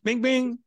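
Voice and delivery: high sing-song bing bing; two bright mock beeps; imitated phone beeps. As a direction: chirp it like a notification sound. Phone beeps from son's purchases.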